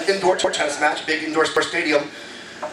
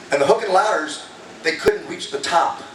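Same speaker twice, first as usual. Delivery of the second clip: normal talking voice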